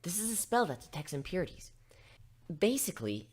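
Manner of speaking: plain reading voice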